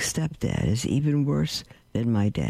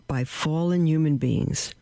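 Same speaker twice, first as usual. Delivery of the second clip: ordinary talk, nothing marked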